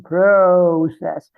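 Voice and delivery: loud, drawn-out Louisiana "PRO-cess"; thick Louisiana twang. Retold or imitated. Imitating American members saying the group's name, thick Louisiana twang. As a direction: stretch it out in a mock Louisiana twang. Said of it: "with a really thick Louisiana twang".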